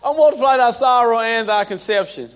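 high, loud preaching of the verse; preaching scripture. Acted. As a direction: proclaim the verse with force.

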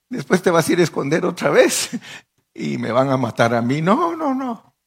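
agitated protest climbing very high; acted panicky refusal; alarmed refusal. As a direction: object loudly, ending in high-pitched no's. Messenger refuses the dangerous errand.

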